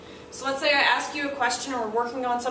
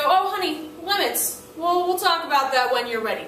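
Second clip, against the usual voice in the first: soothing parent deflecting; 4 semitones higher, 4 dB louder than usual. Retold or imitated. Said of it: "You just go".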